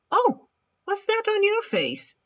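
very high, loud bird voice; raspy cawing crow. Acted. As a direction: squawk it like a curious crow. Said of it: "asked the crow".

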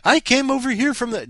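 loud, high-pitched mock announcement; gushing commenter. Host repeating the comment for everybody's link, giddy and loud. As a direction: blurt it out like an overexcited visitor. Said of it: "Do that to everybody's link".

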